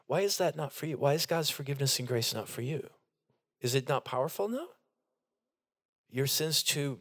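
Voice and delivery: ordinary delivery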